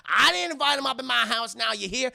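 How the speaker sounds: loud, high acted scolding; mock-furious telling-off at full volume; Madea's angry protest. Acting Madea angrily refusing a guest.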